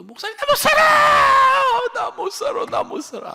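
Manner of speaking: high, loud mock wailing deaconess; imitating a sobbing woman crying out; hammed-up desperate wail. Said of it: "막 울어제끼면서".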